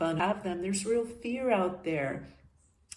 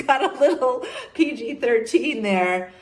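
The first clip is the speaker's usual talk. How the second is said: embarrassed laugh, louder and higher; 8 semitones higher, 8 dB louder than usual. Real, not acted. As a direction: laugh through the line at her own slip. Laughs at her own joke.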